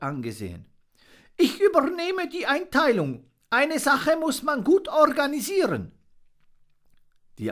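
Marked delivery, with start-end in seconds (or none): 1.4-5.9 very high, important bird voice; bossy bullfinch. Acted. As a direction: take charge like a fussy organiser. Respected bullfinch takes charge.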